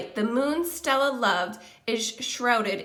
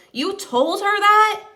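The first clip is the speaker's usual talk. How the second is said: higher, louder outraged question; angry disbelief; 8 semitones higher, 9 dB louder than usual. Acted. Gorilla confronts the dog over his promise, angry. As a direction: demand it, furious. Glares at Bob.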